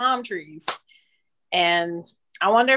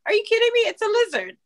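mock incredulous; 10 semitones higher, much faster than usual. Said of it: "I'm like".

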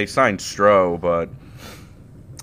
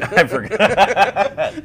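much higher, slow, through laughter; laughing; 11 semitones higher, 6 dB louder, much slower than usual. Real, not acted. Laughing at the joke, higher.

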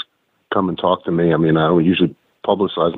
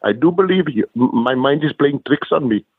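higher cartoon voice; Simpsons character impression; 6 semitones higher than usual. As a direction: quote a confused cartoon character, pitched up. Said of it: "that guy from, uh, from The Simpsons".

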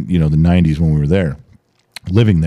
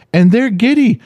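giddy. Retold or imitated. Husband mimics giddy family.

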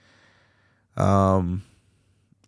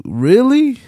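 high, loud incredulous really?; 6 dB louder than usual. Real, not acted. Streamer mocking crying fans, high incredulous really. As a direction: stretch it up in disbelief.

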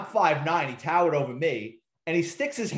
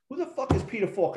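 incredulous partner; 7 semitones higher than usual. Retold or imitated. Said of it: "my partner goes".

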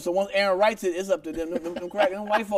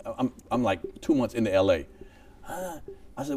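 ordinary talk, nothing marked

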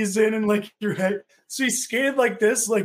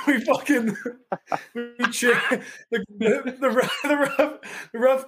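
stumbling starts broken up by real laughter; helpless giggles between the words; laughing through words; 3 semitones higher than usual. Real, not acted. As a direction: try to start the story while cracking up. Cracking up while starting the story.

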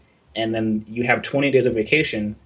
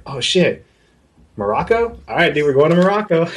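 higher and louder, thrilled at a cheap flight; excited discovery; 6 semitones higher, 4 dB louder than usual. Retold or imitated. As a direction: blurt it out like you just found a bargain.

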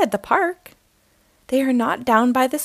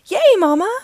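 much louder, high excited cheer; cheering yell; 8 semitones higher, 8 dB louder than usual. A narrator's reading. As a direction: cheer for mom with joy. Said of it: "the Cubs yell".

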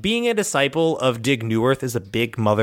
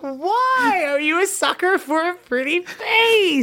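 high, slow laughing question; slower than usual. Real, not acted. Player teasing the flaw roll, laughing high and slow. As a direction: ask it through laughter.

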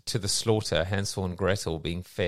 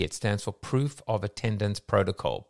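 ordinary delivery

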